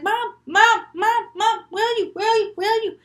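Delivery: high, urgent, repeated calls; frantic high searching cries; freaking out. Acted. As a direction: call for your mother in rising alarm. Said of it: "She's freaking out".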